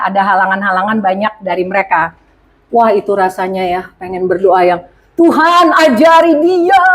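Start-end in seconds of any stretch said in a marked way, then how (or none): 5.2-6.8 mock spiteful prayer. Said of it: "Wah, salah ya".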